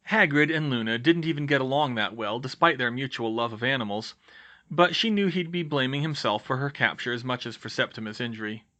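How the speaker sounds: ordinary delivery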